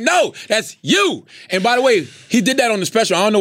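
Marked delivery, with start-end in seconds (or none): 0.0-1.2 raised, slow NO, then a drawn-out YOU; retold punchline. Comic retelling Dave correcting the crowd, emphatic slow punchline. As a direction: stretch "No" and "you" as a corrective reveal. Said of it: "And he was like".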